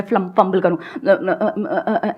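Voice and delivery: fumbling and stuck on uh-uh-uh; stammering demo. Shown as a demonstration. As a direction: act out freezing mid-speech. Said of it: "ऐसे करने लगूँ".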